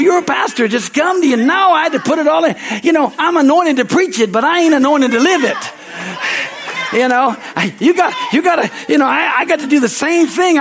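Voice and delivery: rising, loud, fervent preaching; preaching at full force. Acted. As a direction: build the voice up to a shout of faith.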